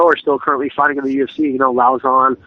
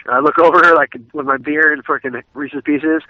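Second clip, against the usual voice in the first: normal talking voice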